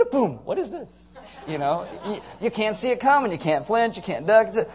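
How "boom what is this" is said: high, laughing what-is-this; surprised blind man. Pretend. Preacher voicing blind man hit with mud, startled laugh. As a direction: play the blind man caught off guard. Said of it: "It's like".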